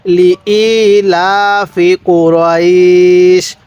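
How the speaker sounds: very slow, sustained vowels; level, measured tajweed reading; stretched recitation. Shown as a demonstration. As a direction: hold the final vowel long before "sh". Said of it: "এখানে টান দিবেন".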